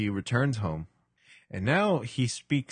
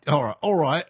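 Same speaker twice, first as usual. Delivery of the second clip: put-on British accent; 4 semitones higher, 4 dB louder than usual. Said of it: "speaks in a British accent".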